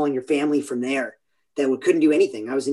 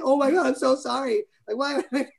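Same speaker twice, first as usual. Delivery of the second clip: high, strained, half-sobbing apology; mock-tearful high-pitched "I'm so sorry"; retold crying apology; 10 semitones higher than usual. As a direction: relive the panicked dad apologizing to his hurt daughter. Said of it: "And I was, I'm crying".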